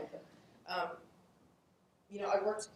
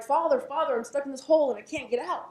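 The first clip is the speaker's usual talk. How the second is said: higher, louder calling up from a hole; man in a hole begging the passing priest, raised voice; desperate plea to priest; 7 semitones higher, 7 dB louder than usual. Acted. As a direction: call up to the priest for help. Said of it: "and he's like".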